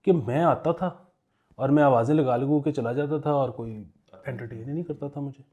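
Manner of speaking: ordinary talk, nothing marked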